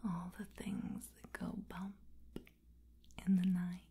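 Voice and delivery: ordinary delivery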